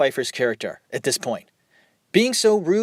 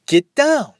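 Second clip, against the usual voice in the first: higher, louder snapped order; exasperated shout; 10 semitones higher, 5 dB louder than usual. Retold or imitated. Mother orders the guests off the counter, shouting. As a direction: yell at them to get down at last. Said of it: "She looks back, is like".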